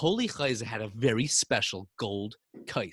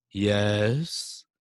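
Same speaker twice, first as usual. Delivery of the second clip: lowered voice posing as the Rebbe; deep voice; 3 semitones lower than usual. Pretend. Boy faking the Rebbe's deep voice to a visitor. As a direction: pretend to be the old Rebbe, voice dropped. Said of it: "put on a deep voice".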